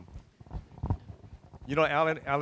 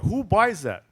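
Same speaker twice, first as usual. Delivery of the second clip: higher and louder, astonished at the huge fish; incredulous; 9 semitones higher, 8 dB louder, slower than usual. Retold or imitated. Farm bureau president shocked at the farmer's huge tilapia, incredulous. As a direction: blurt it out in disbelief.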